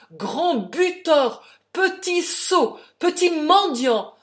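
very loud, high, slow bellowed insults; shouted insults. A narrator's reading. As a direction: hurl each insult like a blow. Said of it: "criait-il". (insultes hurlées, en détachant chaque mot)

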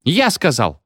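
understated indignant yelp; mild squeal. A narrator's reading. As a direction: pipe up defiantly, only lightly squealed. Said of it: "взвизгнула фигура в окне".

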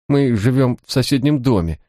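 high squeaky nervous voice; thin, high, nervous squeak. A narrator's reading. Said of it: "писклявым голосом ответил незваный гость".

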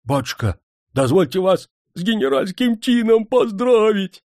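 high, exclaimed congratulation; jubilant congratulation. A narrator's reading. Servant congratulating his master on a general's rank, jubilant. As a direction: burst out with the joyful news.

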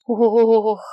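loud, full-bellied "oh"; sated groan. A narrator's reading. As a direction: groan loudly from a stuffed belly. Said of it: "сыто простонала Сигрид".

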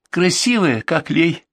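put-on reply simply, like a little girl; маленькая девочка. Little girl describes the woman. (детским голоском, простодушно)

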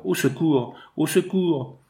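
high-pitched, urgent narrated call; cry for help. A narrator's reading. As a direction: call out for help in restrained alarm. Mother cries for dying daughter's help.

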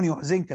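يحزنك with u then i vowels; Nafi's reading. Shown as a demonstration. Teacher reading out Nafi's variant, يحزنك as "yuḥzinka". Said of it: "بضم الياء وكسر الزاي".

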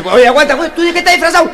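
high, loud shouted exchange; loud high shouted punchline; loud challenge. Acted. Bouncer challenges the guest.

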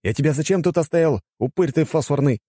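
high-pitched telling-off; exasperated rebuke; scolding. A narrator's reading. Narrator scolds the sleeping old man.

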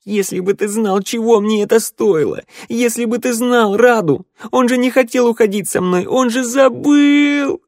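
high, loud outburst; furious scream. A narrator's reading. Sanda turns on the one chasing her, screaming. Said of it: "заорала так, что на белом горле вздулись вены".

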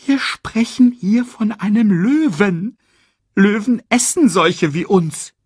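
hoch, laut und langsam belehrend; warnendes Erdmännchen. Acted. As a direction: Timon redet Pumba eindringlich ins Gewissen. Timon warnt Pumba. (hohe, eindringliche Warnung)

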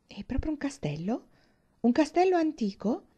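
high, rising questions; eager wonder. Acted. Catherine questioning Thorpe about the castle, eager wonder. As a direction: ask in growing wonder.

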